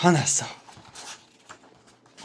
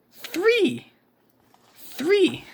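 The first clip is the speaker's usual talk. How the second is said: surprised three; 13 semitones higher than usual. Acted.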